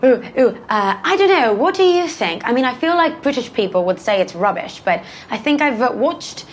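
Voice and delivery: high-pitched British accent demo. Shown as a demonstration. Actress shows her best British accent on request, British accent. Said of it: "give me your best British accent".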